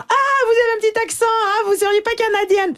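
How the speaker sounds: put-on high, fast, teasing European French accent; mock European French accent. A European local teasing the Canadian guest in a mock European French accent. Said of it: "Il y a toujours quelqu'un pour imiter mon accent".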